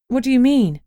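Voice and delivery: a jolt of worry; alarmed question. Acted. Bad news about the curse.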